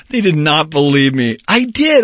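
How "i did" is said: high, emphatic insistence; insisting. Acted. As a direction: push back, insisting it's true. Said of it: "I insisted".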